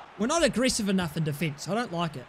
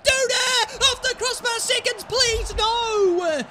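high, loud rising panic; excited yelling; 11 semitones higher, 5 dB louder, slower than usual. Real, not acted. Gamer watching his shot hit the crossbar, shouting. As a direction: scream as the ball hits the bar. Near miss on goal.

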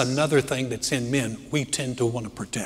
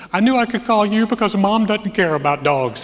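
higher, louder voice of a tearful daughter; imitating crying daughter; 7 semitones higher, 6 dB louder than usual. Daughter calling dad about the sick dog, imitated tearfully. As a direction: play the upset grown daughter, raised and pleading. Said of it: "she's crying on the phone".

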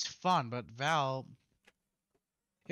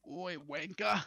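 higher-pitched British slipping into Australian; botched British accent; 5 semitones higher, slower than usual. Acted. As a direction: attempt British, land on mock Australian. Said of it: "that's fucking Australian".

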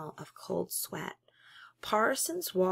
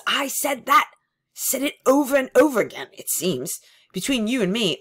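agitated confession; 3 semitones higher, 10 dB louder than usual. Acted. Parsons confesses loudly.

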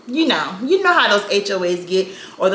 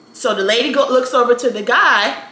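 ordinary talk, nothing marked

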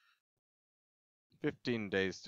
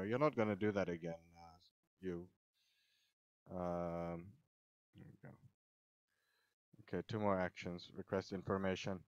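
normal talking voice

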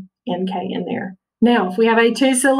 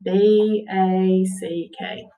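letter by letter, very slow; teacher slowly spelling out B A C K; much slower than usual. Shown as a demonstration. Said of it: "So back is going to be spelled".

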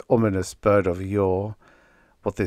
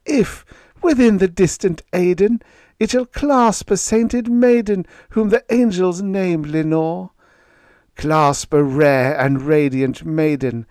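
anguished pleading; 9 semitones higher, 6 dB louder than usual. Acted. Narrator pleads about Lenore.

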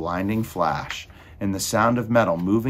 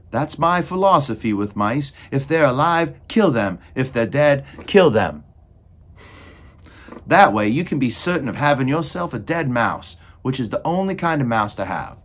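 raised, emphatic character voice; loud ranting; 6 semitones higher, 6 dB louder than usual. A narrator's reading. Cook explains her philosophy with mice, ranting. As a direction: lay down the law loudly.

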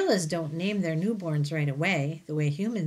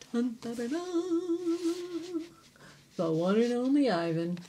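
mock fanfare; 7 semitones higher than usual. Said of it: "fanfare announcing the book title".

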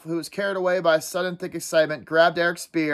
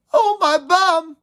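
high, slow, loud wail; rueful pain; 12 semitones higher, 5 dB louder, slower than usual. Acted. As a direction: moan over a sore rump. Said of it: "He rubbed his rump ruefully".

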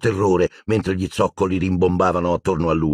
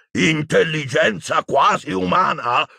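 outraged shout; 10 semitones higher, slower than usual. Acted. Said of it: "urlò Magorian".

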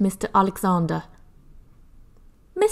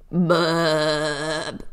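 burp; 4 semitones lower than usual. Acted. Cat burps.

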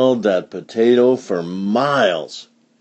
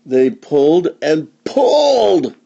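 slow, with a high heave on the last "pulled"; effortful, drawn-out pull; straining storytelling; 6 semitones higher than usual. A narrator's reading. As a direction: stretch the final word with effort.

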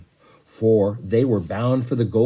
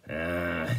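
soft sigh; 5 semitones lower, 7 dB quieter than usual. Acted. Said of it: "he sighed".

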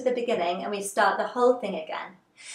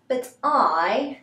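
higher, drawn-out character line; Alice; 3 semitones higher, 3 dB louder, much slower than usual. Acted. Teacher voicing Alice for the mime class, theatrical and drawn out. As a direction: stretch each phrase slowly and theatrically. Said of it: "And then Alice says".

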